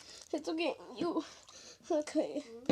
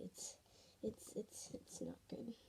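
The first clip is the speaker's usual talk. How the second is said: whisper; 4 semitones lower, 21 dB quieter than usual. Real, not acted. Muttering about sticky slime.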